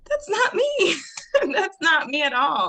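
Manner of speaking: high, loud, laughing denial; laughing protest. Real, not acted. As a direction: protest through a burst of laughter. Laughingly protests father's expectations.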